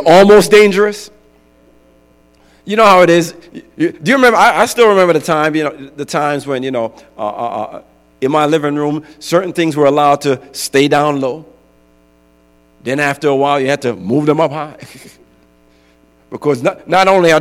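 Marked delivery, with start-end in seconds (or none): none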